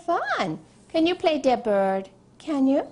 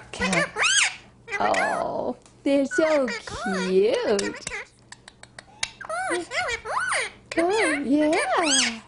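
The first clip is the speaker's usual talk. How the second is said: high cooing; 9 semitones higher than usual. Real, not acted. Owner cooing to baby birds.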